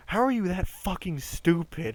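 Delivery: exasperated retold rant, pitch jumping up; retold angry rant. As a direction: imitate yourself going off on someone. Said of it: "started going off on, like, a rant".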